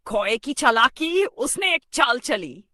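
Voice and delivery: loud, high and slow storyteller flourish; dramatic announcement. A narrator's reading. Dramatic heading of the crow's trick.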